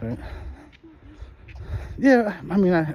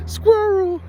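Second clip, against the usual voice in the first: "Squirrel" in a thick New York accent, higher and cracking with laughter; New York accent, laughing. Shown as a demonstration. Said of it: "say squirrel".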